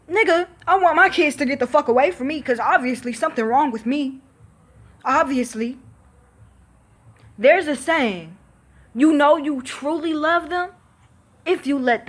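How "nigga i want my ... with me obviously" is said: fast, louder, higher rant; rushed, raised exasperation; heated rant. Real, not acted. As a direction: fire it off angrily. Venting about mother.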